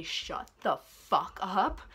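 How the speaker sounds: each word stretched out slowly; very slow, exaggerated, held-back outburst; drawn-out mock outburst. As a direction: drag it out word by word, rising at the end. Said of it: "yell and scream and".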